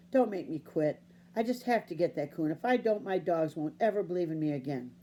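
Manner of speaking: plain reading voice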